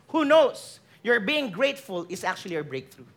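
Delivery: ordinary talk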